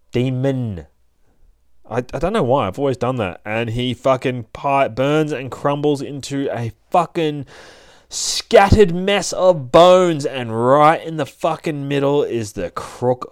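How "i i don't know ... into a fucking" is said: plain reading voice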